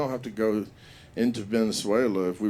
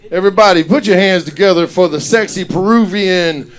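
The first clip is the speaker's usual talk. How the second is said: loud, higher MC hype, stretching the name; hype host intro; 7 semitones higher, 15 dB louder than usual. Real, not acted. Host introducing Shorty to the crowd, loud hype. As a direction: bring the comic on with full crowd hype.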